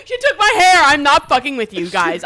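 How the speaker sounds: loud, high, real laughing protest; laughing insistence. Real, not acted. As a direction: insist through your own laughter. Laughing while insisting the hex was real.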